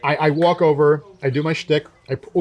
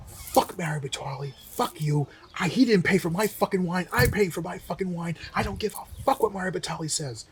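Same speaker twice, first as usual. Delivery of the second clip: imitated tirade, kept soft but pitched up; hushed mock yell; 4 semitones higher, 6 dB quieter than usual. Irate diner yelling at the sommelier, retold as a hushed mock yell. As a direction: act out yelling without the volume. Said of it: "But then he yells".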